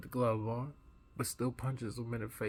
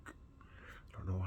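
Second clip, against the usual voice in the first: whisper; 3 semitones lower, 8 dB quieter, much slower than usual. Retold or imitated. Inner thought, hushed.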